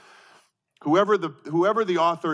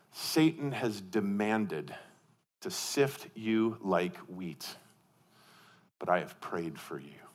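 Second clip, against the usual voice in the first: slow, soft and much lower, gently assuring; Jesus speaking tenderly; 7 semitones lower, 8 dB quieter, slower than usual. Retold or imitated. Jesus gently warning Peter at the last supper, slow and soft. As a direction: lean in and soothe him like a friend. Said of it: "Jesus said".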